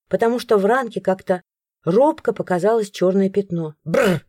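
plain reading voice

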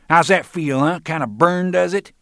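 a bully jeering at his victim; loud taunting. Acted. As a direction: crow it over him while grinding knuckles. Roland taunting during knuckle rub.

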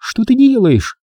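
high-pitched protest, little added volume; narrated outcry, pitched well up; mild cry of protest. A narrator's reading. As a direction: cry out in high, understated alarm. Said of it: "кричала Рен".

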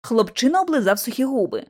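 plain reading voice

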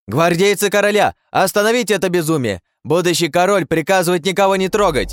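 shouting commands. Acted. Hero shouting commands at guards.

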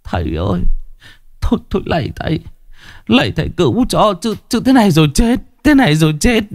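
a terrified father crying to the master to save him; sobbing plea. A narrator's reading. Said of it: "đã phát khóc, mếu máo".

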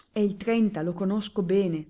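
plain reading voice

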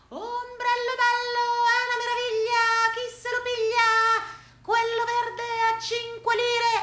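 high singsong hawking; street vendor's cry. Acted. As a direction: chant your wares on one high, held note. Acts an umbrella seller's cry.

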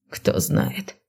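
low hushed ominous remark. A narrator's reading. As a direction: drop it darkly, barely voiced. Narrated meaningful shrug, ominous. (зловеще, вполголоса)

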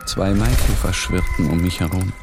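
ordinary talk, nothing marked

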